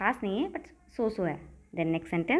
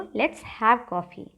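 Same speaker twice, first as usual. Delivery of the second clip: very slow and even; slow drill; much slower than usual. Shown as a demonstration. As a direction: lay out each word for the class. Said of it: "या फिर आप कह सकते हो".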